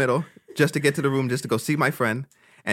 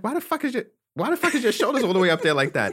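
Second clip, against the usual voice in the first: mocking question; 9 semitones higher than usual. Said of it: "She's like".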